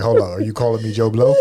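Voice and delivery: loud, lightly put-on everyman protest; Joe Blow voice. Playing Joe Blow objecting to the name, mock-offended Joe Blow voice. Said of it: "now you sound like Joe Blow".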